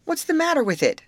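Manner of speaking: high, loud demand for an answer. A narrator's reading. Molly questions Betty about the boat, demanding. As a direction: demand it sharply, pitch jumping up. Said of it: "demanded Molly".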